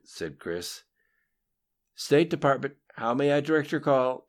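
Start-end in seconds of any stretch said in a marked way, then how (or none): 1.9-4.3 lightly voiced operator, higher and louder; a hint of a female phone operator; female switchboard operator. A narrator's reading. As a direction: answer the call in a raised, projected operator voice. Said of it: "came the female voice over the speakerphone".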